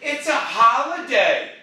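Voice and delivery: high, loud, drawn-out mock excuse. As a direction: stretch out the excuse mockingly. Mimics excuses for quiet days.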